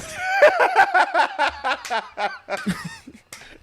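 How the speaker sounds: high burst of real laughter; loud laughter. Real, not acted. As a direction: cackle out loud.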